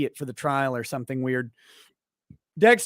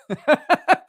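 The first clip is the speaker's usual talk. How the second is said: laugh; 10 semitones higher, 6 dB louder than usual. Real, not acted. Laughs at chat joke.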